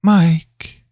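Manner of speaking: put-on high, childlike call; little girl's voice. A narrator's reading. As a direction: call out as a small girl. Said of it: "it said in a little girl's voice".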